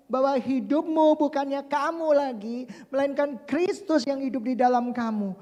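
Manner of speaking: ordinary talk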